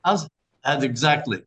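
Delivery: normal talking voice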